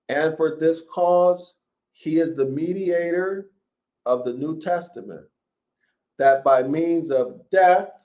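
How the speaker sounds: scripture reading. Acted. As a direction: proclaim each phrase like a preacher at the pulpit. Continues the verse, raised.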